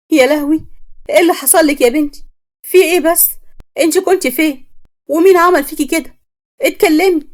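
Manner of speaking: high, loud frantic wail at the daughter; panicked cry. Acted. Mother to her returned daughter, panicked high loud cry.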